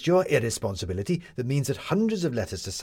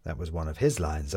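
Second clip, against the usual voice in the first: ordinary delivery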